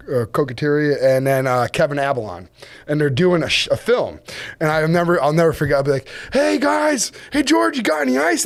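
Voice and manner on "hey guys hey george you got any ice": calling out. Retold or imitated. Calling across to other boat.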